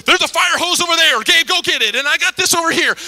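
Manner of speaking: high, loud barked commands; shouting orders. Retold or imitated. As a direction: take charge and bark orders. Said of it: "she started shouting orders".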